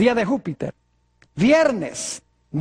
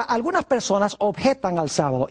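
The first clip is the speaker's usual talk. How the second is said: ordinary talk, nothing marked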